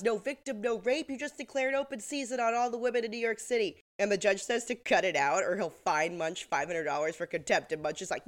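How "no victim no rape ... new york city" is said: pitched up, indignant retelling; raised mock outburst. Quoting Munch to the judge, a raised pitch rant. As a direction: deliver it as an angry rant. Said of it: "And he goes".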